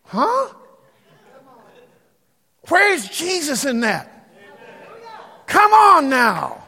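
high, loud, drawn-out preacher call; preaching challenge. Acted. Pastor demands an answer from the church, loud and slow. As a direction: press the congregation hard.

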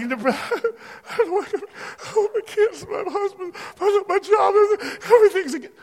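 high, put-on voice of a churchgoer wanting her old life back; mock whiny complainer. Pastor mimics a complaining churchgoer, whiny and high. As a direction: send up the self-pitying believer.

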